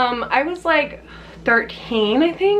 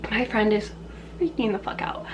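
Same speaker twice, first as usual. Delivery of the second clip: ordinary delivery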